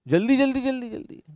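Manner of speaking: high, hurried teasing urge; urgent teasing. Real, not acted. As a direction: hurry her along playfully. Urging student again. (हँसती छात्रा को जल्दी करने को कहते हुए छेड़छाड़)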